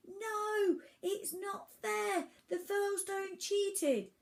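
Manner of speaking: high-pitched slow cry from afar; trapped captive's high, drawn-out protest; shouted protest. A narrator's reading. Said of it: "pounded his hand ... and shouted".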